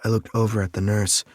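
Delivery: plain reading voice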